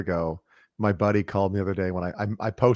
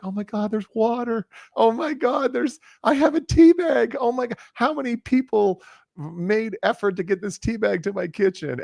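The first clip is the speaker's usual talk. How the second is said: Jim Carrey excitement; 13 semitones higher, 3 dB louder than usual. Retold or imitated. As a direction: gush like a cartoon actor amazed by everything. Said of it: "like a Jim Carrey character".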